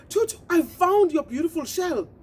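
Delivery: high, eager bird's good news; a little bird bursting with good news; excited pigeon. Acted.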